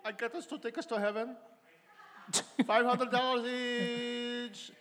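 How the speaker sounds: high, put-on wife voice; wife's voice. Husband hears wife brag about heaven tickets, high mock wife voice. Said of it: "your wife coming home from church and saying".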